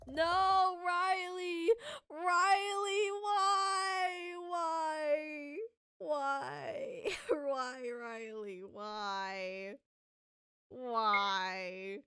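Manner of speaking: high anguished wailing why; wail, fading to defeat; despairing wail. Real, not acted.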